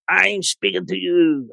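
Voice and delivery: a hint of a ghost, drawn out slowly; mild ghostly voice. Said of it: "was doing this".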